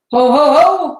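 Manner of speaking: high, loud cheering roar; loud joyful ho ho ho; crowd roaring. A narrator's reading. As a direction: bellow with the crowd as the roof falls. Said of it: "roared the crowd".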